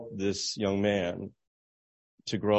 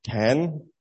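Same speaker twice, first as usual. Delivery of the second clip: nervous guess; 4 semitones higher than usual. Retold or imitated. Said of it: "And he said".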